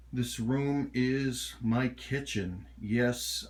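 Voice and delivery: ordinary talk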